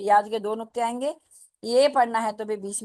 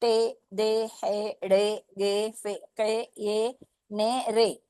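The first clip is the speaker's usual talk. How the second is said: बे, टे each syllable drawn out slowly, e sound held; slow teaching read of बे, टे; slow syllable drill; much slower than usual. Shown as a demonstration. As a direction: spell out बे, टे slowly for young learners. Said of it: "तो कैसे आवाज आएगी?".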